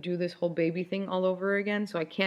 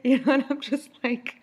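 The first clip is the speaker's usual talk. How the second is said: talking through a real laugh; 7 semitones higher, 3 dB louder than usual. Real, not acted. Laughing at her own meltdown story, higher. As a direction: laugh it off while speaking.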